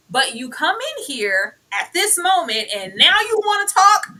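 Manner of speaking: loud, high, incredulous telling-off; indignant confrontation. Acted. Acted angry confrontation of a woman.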